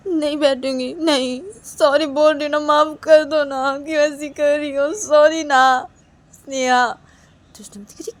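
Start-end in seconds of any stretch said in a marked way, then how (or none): 0.1-7.0 higher, drawn-out begging; whiny pleading. Acted. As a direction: plead stubbornly, stretching the words. Neha refuses, pleads with angry Sneha. (मिन्नत भरी ज़िद)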